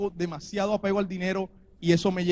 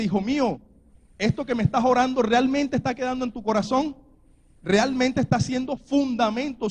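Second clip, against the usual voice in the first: God's words preached higher and louder; preacher voicing God to his child, fervent and raised; fervent preaching; 5 semitones higher, 4 dB louder than usual. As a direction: proclaim it like a promise from above.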